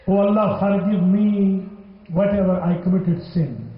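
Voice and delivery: normal talking voice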